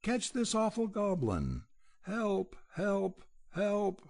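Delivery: high panicked calls to catch the goblin; terrified cry. Acted. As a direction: scream for help, high and frightened. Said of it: "terrified out of his wits".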